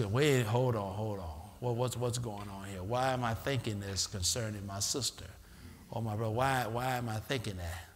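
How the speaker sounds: low, soft self-questioning; puzzled inner voice. Retold or imitated. Believer questioning their own suspicious thoughts, quietly. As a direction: wonder at yourself quietly.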